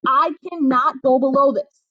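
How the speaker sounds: ordinary delivery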